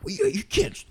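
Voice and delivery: high, put-on Philly accent, mocking the fans; squeaky mock Philly accent. Fans defending their boy, in a high mock Philly accent. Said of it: "I've never met anyone in Philadelphia that talks like that".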